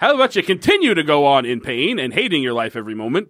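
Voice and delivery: high pitched commercial pitch; hard sell ad. Retold or imitated. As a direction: sell it like a cheesy ad. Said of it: "doing it to the style of a commercial".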